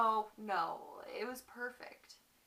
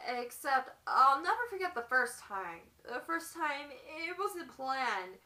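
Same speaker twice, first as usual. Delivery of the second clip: higher, halting, shaken recollection; acted distress; 6 semitones higher, 9 dB louder, much faster than usual. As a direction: relive the shock in a raised, stumbling voice. Acts wife recalling first beating.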